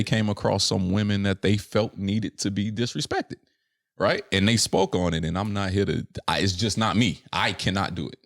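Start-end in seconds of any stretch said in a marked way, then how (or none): none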